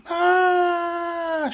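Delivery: shrill piercing high scream of horror; a sudden terrified shriek; bloody murder scream. Acted. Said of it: "Maria screams bloody murder".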